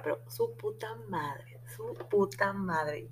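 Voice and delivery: slow, breathless whisper of disbelief; whispered curse. Real, not acted. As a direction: hold the excitement in and whisper it slowly. Emoción contenida tras recibir pases. (susurro lento, conteniendo la emoción)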